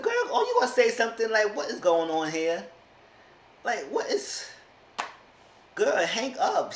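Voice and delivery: high, fast, laughing disbelief; exasperated sass. Retold or imitated. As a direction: scold her through a laugh, voice way up. Scolding Sarah on the show.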